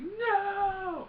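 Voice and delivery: high, drawn-out angry cry; Luke. Retold or imitated. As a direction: yell it as an enraged outburst. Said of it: "Luke gets angry. He's like".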